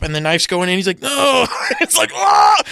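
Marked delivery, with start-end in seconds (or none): 1.0-1.5 mock cry. Victim's cry as the knife goes in. 2.1-2.6 high hammed-up scream of pain; mock scream. Retelling the stabbing scene with a loud mock scream. Victim screams as the knife goes in.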